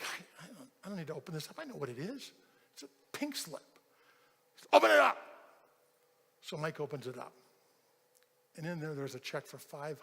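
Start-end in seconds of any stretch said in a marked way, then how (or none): 0.0-3.6 very soft, hesitant imitation; dejected mumble. Worker certain he is fired, quietly reluctant. 4.7-5.2 loud, high imitation of an impatient boss; foreman's shout. Foreman ordering worker to open envelope, shouted.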